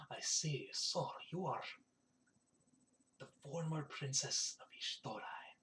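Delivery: very soft, low, secretive whisper. Acted. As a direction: breathe it like a confidential discovery. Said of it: "he kinda whispers".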